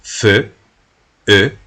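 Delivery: "Feu" con EU redondeada y fuerte; vocal francesa marcada. Shown as a demonstration. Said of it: "aquí está otro". (demostración en voz alta de la vocal)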